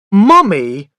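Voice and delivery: put-on a loud, high child's shout. A narrator's reading. Jealous boy shouting for his mother, loud. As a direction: yell for her across the room. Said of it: "I shouted".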